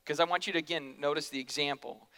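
usual speaking voice

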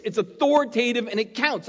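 ordinary talk

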